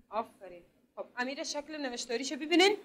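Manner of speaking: normal talking voice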